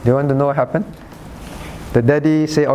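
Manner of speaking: ordinary talk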